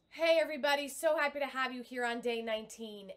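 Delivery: powerful on-the-breath hello; breath-supported voice. Shown as a demonstration. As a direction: speak out on the breath, full and grounded. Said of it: "that is where my voice is coming out".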